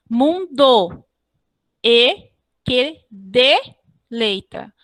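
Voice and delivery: "Mundo e que deleita" slowly, each beat separate; deliberate counting of "Mundo e que deleita"; syllable-by-syllable scansion. Shown as a demonstration. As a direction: pause between syllables, setting "de" apart from "leita". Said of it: "mais um exemplo de elisão".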